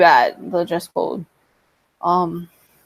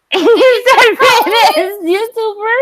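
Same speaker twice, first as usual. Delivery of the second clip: very high, loud laughing squeal; laughing disbelief; 13 semitones higher, 5 dB louder, slower than usual. Pretend. Streamer reacting to the famous YouTuber joke, high laughing disbelief. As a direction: squeal the question through laughter, drawn out.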